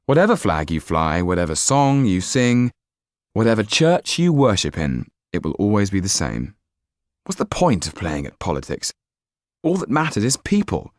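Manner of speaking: put-on young Jan. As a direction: speak up with youthful idealism. Narrator voices young Jan.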